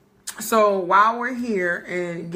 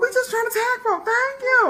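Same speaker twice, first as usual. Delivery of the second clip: much higher, sassy mock voice. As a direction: squeal it back in mock sass. Mocking reply to Facebook.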